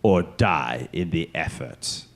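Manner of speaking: slow, weighty, commanding emperor. Retold or imitated. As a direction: pronounce each word like a dark master. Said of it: "A long pause and then".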